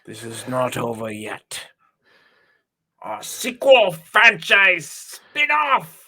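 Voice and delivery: milk the last line slowly; Merovingian rant. Retold or imitated. Ending the Merovingian parody for a friend, slow and hammy. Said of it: "And a little pause".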